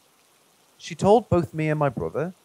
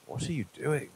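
a menacing murmur from the dark; creepy voice; 8 dB quieter than usual. Acted. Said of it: "in a creepy voice".

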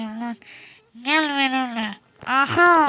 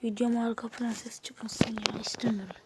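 hushed whisper, voice kept down; 2 semitones lower, 7 dB quieter than usual. Real, not acted. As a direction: whisper it so the mic picks up little. Whispering so the video stays quiet.

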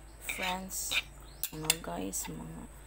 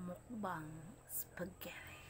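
whispering; 10 dB quieter, slower than usual. Acted. Mukbang intro, whispered word.

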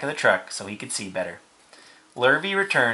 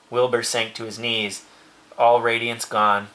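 plain reading voice

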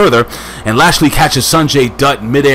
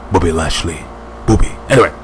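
usual speaking voice

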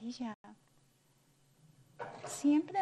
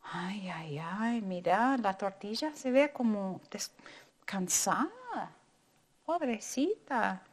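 usual speaking voice

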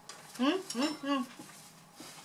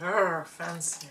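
slow, lower, posh-mocking "fancy"; 5 semitones lower, much slower than usual. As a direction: drag out "Arr, fancy" slowly to tease. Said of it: "Not the way you said it. It's like".

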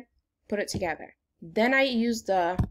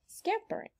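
scampering said much higher, lightly; bright repeat; 12 semitones higher, 9 dB quieter than usual. Shown as a demonstration. Teacher repeating the word for kids, high and light. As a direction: pop scampering up high the second time. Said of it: "How many syllables".

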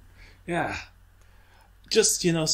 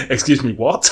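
louder, laughing retold bewilderment; laughing disbelief; 3 semitones higher, 8 dB louder than usual. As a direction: laugh through the stunned question. Told he gets the ball.

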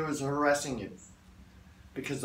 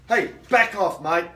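louder, forceful warning; stronger, assertive; 3 semitones higher, 7 dB louder than usual. Shown as a demonstration. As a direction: raise your voice and make them back off. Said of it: "start to be a bit stronger".

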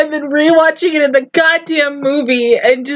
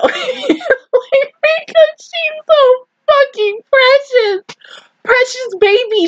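much higher, slow, tear-choked voice; tearful gush; 11 semitones higher, slower than usual. Real, not acted. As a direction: drag it out, crying with affection. Crying over precious character.

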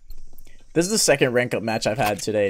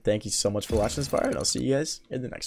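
YouTube outro; 6 semitones lower, 8 dB quieter than usual. Acted.

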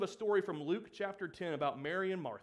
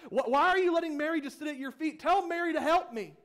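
frustrated complaint; 6 semitones higher than usual. Acted. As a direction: pile up the grievances to the Lord. Martha voiced complaining to Jesus.

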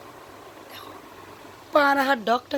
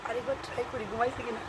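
hushed aside; 2 semitones lower, 10 dB quieter than usual. Real, not acted. Aside while baby falls asleep.

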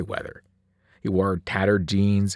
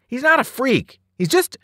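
high, loud, heated retort; raised-pitch outburst; indignant protest; 12 semitones higher, 5 dB louder than usual. A narrator's reading. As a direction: defend a friend hotly, then break off. Boy defends friend against bully.

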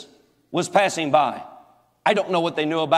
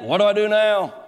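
mock God. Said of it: "And God said".

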